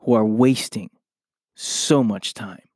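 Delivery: ordinary talk, nothing marked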